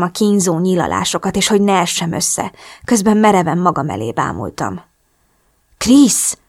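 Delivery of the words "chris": higher, startled call of the name; alarmed cry. A narrator's reading. Drew spots injured Chris, alarmed cry. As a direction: call out in sudden fright. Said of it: "hallottam Drew rémült hangját".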